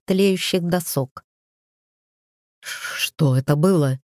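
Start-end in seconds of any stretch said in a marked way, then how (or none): 2.6-4.0 slow shaky frightened whisper; trembling whisper. A narrator's reading. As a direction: whisper it, shaken by the blast. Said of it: "дрожащим голосом прошептал Вестер".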